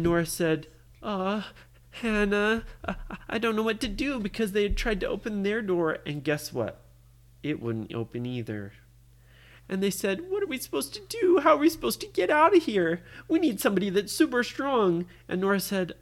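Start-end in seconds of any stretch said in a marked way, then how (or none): 1.0-4.2 worried. Acted. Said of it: "she was feeling kinda scared too". 10.3-15.1 high, fast, worried questions; panicky fretting. Acted. Trapped girls wonder how to get out, panicky.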